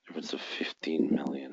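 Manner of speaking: whispered count under his breath. Real, not acted. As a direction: murmur the figure with no voice. Whispering numbers while watching.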